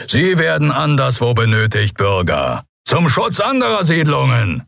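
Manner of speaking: high, projected official answer; captain calling out. A narrator's reading. Captain explains the missing troops to citizens, calling out. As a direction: announce it over the mob.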